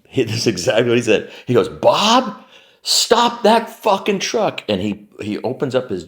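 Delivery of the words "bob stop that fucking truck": high, drawn-out shout; retold shouted order. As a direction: imitate a yelled order to halt, slow and high. Partner orders driver to stop.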